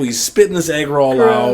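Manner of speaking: normal talking voice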